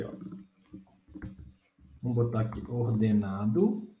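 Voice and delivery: ordinary talk, nothing marked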